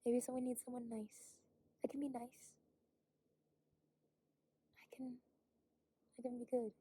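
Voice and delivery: soft unvoiced whisper to herself. Real, not acted. Whispering to herself while hiking alone. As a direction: breathe the words, barely voiced. Private whispered musing to self.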